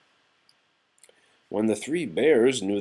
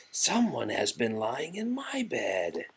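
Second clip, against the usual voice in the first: soft, higher mother-bear voice; Mama Bear; 5 semitones higher, 6 dB quieter than usual. A narrator's reading. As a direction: speak softly and high as a mother bear. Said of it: "in her middle-sized voice".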